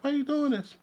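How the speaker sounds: hammed-up freaked-out guard shrieking; panicked officer. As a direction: imitate the officer losing it in a squeaky panic. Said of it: "screaming at him".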